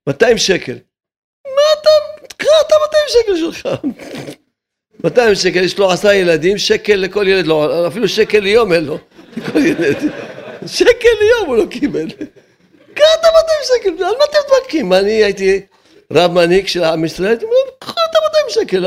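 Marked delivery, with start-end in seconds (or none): 1.5-3.7 high mocking voice. Rabbi scoffing at those arguing over two hundred shekels, high and mocking. 10.7-14.9 high mocking voice. Rabbi scoffing at those arguing over two hundred shekels, high and mocking. 17.8-18.9 high mocking voice. Rabbi scoffing at those arguing over two hundred shekels, high and mocking. Rabbi voicing his imagined reply.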